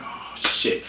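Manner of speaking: hushed, breath-only alarm; whispered dread. Retold or imitated. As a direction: whisper it as if dad is coming. Imagined dread at dad's footsteps.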